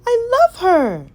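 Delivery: high, loud delighted cry; gushing over a new puppy; happy exclamation. A narrator's reading. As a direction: burst out with joy. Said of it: "Darling exclaimed happily".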